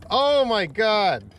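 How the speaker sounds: loud, drawn-out and high; stunned with joy; slow awed exclamation. Real, not acted. Angler amazed at the bigger catch, loud and slow.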